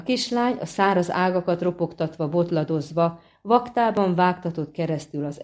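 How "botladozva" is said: usual speaking voice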